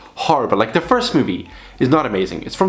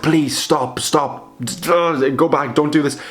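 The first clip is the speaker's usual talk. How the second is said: higher-pitched, half-serious pleading; 3 semitones higher, slower than usual. Retold or imitated. Viewer speaking to the TV during a horror movie, pleading. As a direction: beg the characters to turn back. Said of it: "speaking to the T V".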